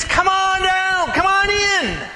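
booming showman invitation; game-show host welcome. Retold or imitated. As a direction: invite like a TV host calling contestants. God inviting, game-show style.

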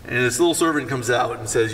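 ordinary delivery

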